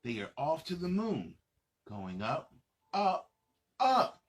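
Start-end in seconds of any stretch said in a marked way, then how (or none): none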